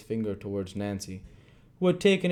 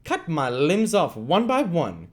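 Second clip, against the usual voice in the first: loud, slow, higher furious vow; angry cry; 8 semitones higher, 6 dB louder than usual. Acted. Sikes refusing Nancy, slow angry cry. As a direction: spit out each word. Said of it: "cried Sikes".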